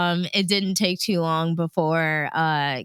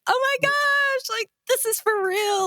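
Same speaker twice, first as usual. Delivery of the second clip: excited squeal. Retold or imitated. Jumping on the steps after the radio call, overjoyed. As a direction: gush like you just got the dream job.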